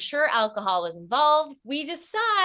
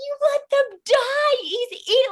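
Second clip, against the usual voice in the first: high, loud accusing wail; hammed-up hysterical blame; freaking out; 13 semitones higher, 4 dB louder than usual. Friend blaming pet owners for the dead hamster, high hysterical wail. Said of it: "we're freaking out".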